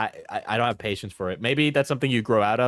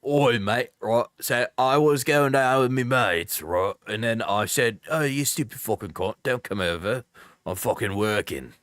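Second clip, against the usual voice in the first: laddish British "Oi, mate" accent; Candor accent. Shown as a demonstration. Streamer showing off an old British accent bit, exaggerated accent. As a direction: do a put-on British geezer accent. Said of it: "the Candor accent I've done sometimes, where it's like".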